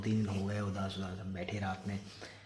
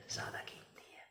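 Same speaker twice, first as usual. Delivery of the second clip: whispered repeat; 17 dB quieter than usual. Real, not acted. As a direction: whisper ज़्यादा कीमती है as an echo.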